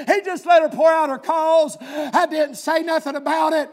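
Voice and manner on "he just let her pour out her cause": preaching at full force. Acted.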